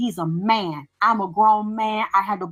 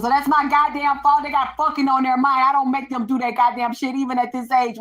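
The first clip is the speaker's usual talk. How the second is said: higher-pitched retold protest; indignant retort; 5 semitones higher than usual. Answering his blame in a retold argument, indignant retort. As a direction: push back, acting out the exasperation.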